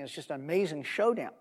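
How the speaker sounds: ordinary talk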